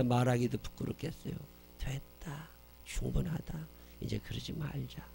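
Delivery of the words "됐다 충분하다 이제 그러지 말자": much softer, gentle murmur; hushed tender father. Acted. The father embracing his son, very soft and tender. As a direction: hold him and say it softly. Voicing the forgiving parent.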